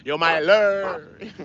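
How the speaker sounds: "Larry" slow and high, slurred into one syllable; drawn-out LARRY. Shown as a demonstration. Streamer playing on a viewer's name, long slurred call. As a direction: stretch "Larry" right out, swallowing its ending. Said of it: "You gotta say it like that".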